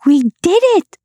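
very high, bright cheering creatures; triumphant creature shout. A narrator's reading. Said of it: "shouted the night creatures".

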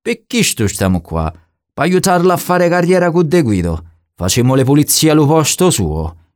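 high-pitched southern Italian bandit; dialect-speaking bandit. Acted. (bandito meridionale, voce acuta)

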